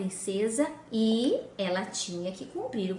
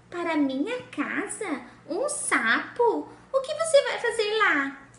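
spoiled princess; 6 semitones higher than usual. Acted. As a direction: squeal it, half disgusted and half eager. Princess appalled.

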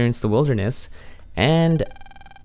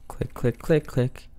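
whispered click sounds; 6 dB quieter, slower than usual. Retold or imitated. Imitating typing sound.

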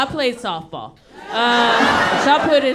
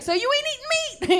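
much higher, fast mocking mom voice; mock shrill mom; faster than usual. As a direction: squeak it out like an outraged mom. Mom's pissed outburst.

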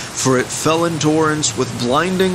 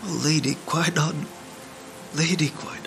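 soft, higher-pitched reverent murmur; hushed awe; 4 semitones higher, 8 dB quieter than usual. Acted. Poor peasant thanking the goddess for a child, hushed awe. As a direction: softly breathe the name like a prayer. Peasant marvels at gift of baby.

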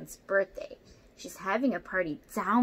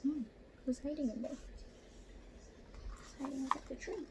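hushed question; 4 semitones higher, 9 dB quieter than usual. A narrator's reading. Asking the child about hidden watcher.

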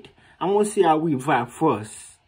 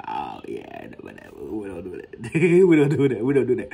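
mock unimpressed woman; 4 semitones lower than usual. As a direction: play her brushing it off as nothing. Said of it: "she be like".

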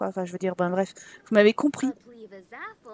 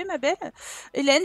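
normal talking voice

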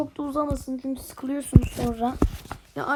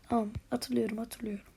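fading mutter; 3 semitones lower, 8 dB quieter than usual. Real, not acted. Gamer repeating to himself, fading.

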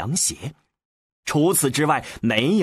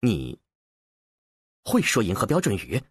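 halting, low, dumbfounded question; 7 semitones lower than usual. A narrator's reading. Trevize to the Solarian who speaks Galactic, dumbfounded. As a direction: stall on the first word, then blurt the rest quickly. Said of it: "他傻傻地问道".

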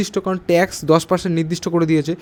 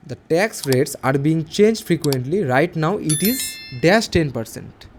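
slow dictation read; much slower than usual. Shown as a demonstration. Teacher rereads exercise sentence.

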